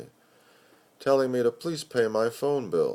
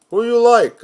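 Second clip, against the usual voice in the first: high, loud call across the bar; brash stranger calling out; loud racetrack voice; 13 semitones higher, 12 dB louder than usual. Acted. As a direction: shout the question over the noise.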